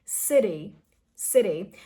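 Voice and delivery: "City" with T as a very soft D; "city" with a quick flapped T. Shown as a demonstration. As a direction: say "city" like a light "siddy". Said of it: "a very soft d sound".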